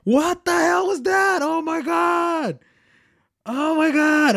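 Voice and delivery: loud, high stunned outburst; real disbelief, shouting at what you just saw; shocked shouting. Real, not acted. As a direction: yell it like you can't believe your eyes. Stunned reaction to the trailer.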